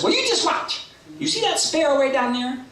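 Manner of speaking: high, cocky bird voice; Berchicken Hawk. Acted. Hawk boasts about catching a sparrow, high cocky voice. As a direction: show off in a high squawk. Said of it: "Berchicken Hawk says".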